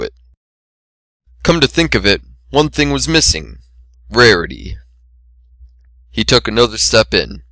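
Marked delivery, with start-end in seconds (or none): none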